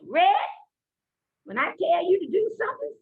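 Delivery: granny voice. Retold or imitated. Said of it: "I like to talk like my granny".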